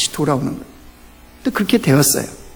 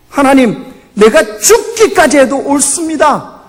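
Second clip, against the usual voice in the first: stubborn, slow protest with a higher pitch; Jonah defiant; 7 semitones higher, slower than usual. Retold or imitated. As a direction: play Jonah digging in his heels to God. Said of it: "요나가 어떻게 해요?".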